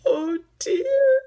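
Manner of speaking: high, loud crying wail; sobbing. Acted. Duck despairing they cannot reach the attic, sobbing. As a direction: break into a sob. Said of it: "he sobbed".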